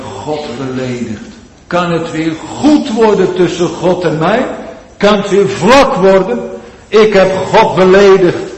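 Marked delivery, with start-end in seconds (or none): 1.7-6.5 loud, high pleading sermon voice; fervent preaching. Acted. Preaching at full force. 6.9-8.5 louder and higher, pleading; anguished confession. Acted. Sinner's anguished confession voiced.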